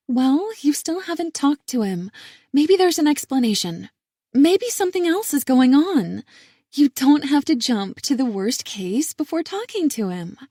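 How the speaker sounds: sister voice. A narrator's reading. Sister voice, higher and louder.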